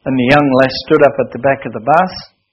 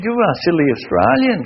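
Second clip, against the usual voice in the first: imitated scolding in a higher voice; incredulous Russian woman; 5 semitones higher than usual. Russian teacher to the Australian proposing a bus concert, incredulous. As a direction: scoff with high, swooping pitch. Teacher scoffs at his suggestion.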